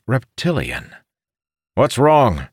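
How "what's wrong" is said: calling out in alarm; shouted. Acted. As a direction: shout it from behind. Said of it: "Dardel shouted".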